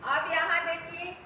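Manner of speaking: ordinary delivery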